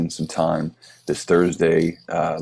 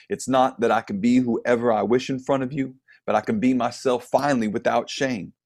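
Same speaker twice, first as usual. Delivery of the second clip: higher-pitched, rhythmic spoken-word delivery; poetry reading; 5 semitones higher, 3 dB louder than usual. Acted. Poet reciting an ode to the waitress for a festival audience, declaimed. Reads his poem aloud.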